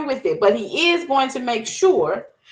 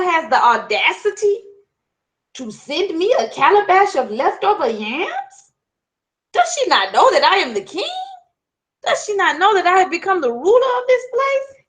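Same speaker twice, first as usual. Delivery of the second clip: higher, louder offended rant; indignant king; 8 semitones higher, 5 dB louder than usual. Acted. Anger rebukes Eshu over the yams, loud high outrage. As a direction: bluster like a new king insulted. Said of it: "seething in his madness".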